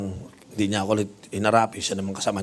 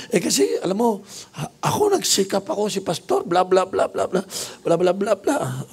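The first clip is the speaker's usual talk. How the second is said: high, whiny gossip; complaining nurse voice; 9 semitones higher than usual. Retold or imitated. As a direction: gripe about the pastor, pitched way up. Nurse complains about her pastor. (nagrereklamong nars, mataas ang boses)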